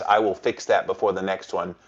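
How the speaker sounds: ordinary talk, nothing marked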